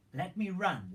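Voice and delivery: high, loud eager plea; eager runner. Retold or imitated. Zadok's son begs Joab to let him run, excited. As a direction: beg to run, bursting with eagerness. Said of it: "he's just so excited".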